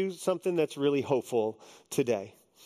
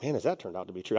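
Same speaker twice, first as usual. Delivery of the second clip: a low, sly joke about marriage; deadpan tease; husband's low wry quip at his absent wife; mock rueful aside; 10 semitones lower, 3 dB quieter, much faster than usual. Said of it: "And I'm like".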